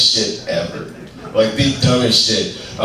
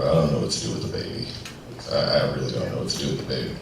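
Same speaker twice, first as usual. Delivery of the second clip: fast, low, softer flustered mumble; 7 semitones lower, 9 dB quieter, much faster than usual. Retold or imitated. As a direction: rattle it off quietly, flustered. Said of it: "He said".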